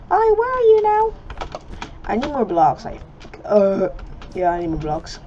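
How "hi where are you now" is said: high, loud, goofy squeak; silly put-on voice. Player hunting an annoying opponent, silly high voice. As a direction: chirp it loudly in a silly high voice. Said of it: "I'm gonna start speaking like this".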